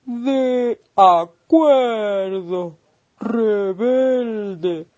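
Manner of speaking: voz de tortuga lentísima, con vocales alargadas; tortuga pausada. Acted. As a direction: responde muy despacio, arrastrando cada palabra como una tortuga. La tortuga contesta al caracol. (tortuga muy lenta y pausada)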